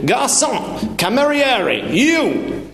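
high-pitched boisterous hail; shouting. Acted. Father summons the waiter in foreign words, shouting. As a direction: shout for service across an empty restaurant. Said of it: "he shouted".

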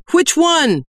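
high, loud demand. A narrator's reading. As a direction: demand an answer over the noise. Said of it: "Lomo demanded over a chorus of wails".

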